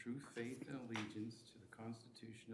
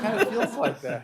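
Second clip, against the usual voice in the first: laughing; 12 semitones higher, 23 dB louder than usual. Real, not acted. Laughing during photo setup.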